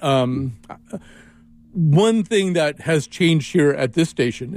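ordinary talk

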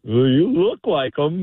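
put-on drunk young man. Drunk kid talking to a stranger at the bar, mock drunk. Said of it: "fully inebriated kid".

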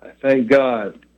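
ordinary delivery

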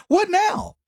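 high, mocking "What now?"; sneering gotcha; mocking taunt. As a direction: taunt them with a smug question. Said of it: "I'm like".

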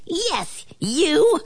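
high little shout, held back; mock shout. A narrator's reading. Flea confirms blame to ant, high mock shout. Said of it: "shouted the flea".

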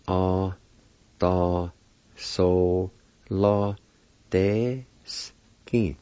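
"Ah, da, so, la, deski" with long gaps; syllable by syllable. Shown as a demonstration. Teacher showing how pendulum is said, syllable by syllable. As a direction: sound out each syllable slowly. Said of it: "it's pronounced like this".

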